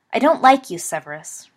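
forceful, cold statement, dropping on the name. A narrator's reading. Remus faces the boy he dislikes, coldly. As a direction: tell him coldly, hitting the first words hard. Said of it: "Remus said coldly".

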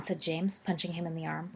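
ordinary delivery, nothing marked

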